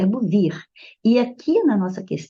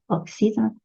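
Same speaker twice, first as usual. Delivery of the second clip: ordinary delivery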